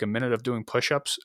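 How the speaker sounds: ordinary talk, nothing marked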